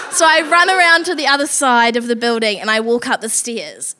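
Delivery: normal talking voice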